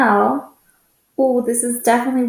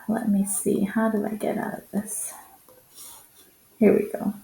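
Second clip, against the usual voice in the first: muttered aside; 7 dB quieter than usual. Real, not acted. Muttering to herself at computer.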